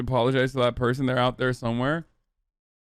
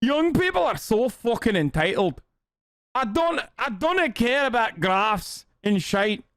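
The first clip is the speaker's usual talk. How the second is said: higher, hammed-up Scottish boomer; Scottish accent; 7 semitones higher, slower than usual. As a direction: rant in a mock Scottish accent, slowly on the second line. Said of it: "Okay, here, I'll do Scottish".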